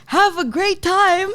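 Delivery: high, slow, smiling singsong; beaming send-off. Retold or imitated. Guard happy with the response sends them off, mock beaming. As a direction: imitate a suddenly cheery guard, big smile in the voice. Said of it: "back to the biggest smile".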